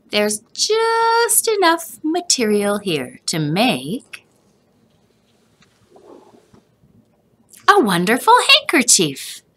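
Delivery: a grandpa's proud idea; grandfather figure. A narrator's reading. Grandfather reveals the handkerchief to Joseph.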